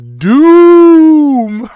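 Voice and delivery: loud, drawn-out alien boom. Retold or imitated. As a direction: bellow it like a sci-fi alien announcer. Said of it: "and the alien goes".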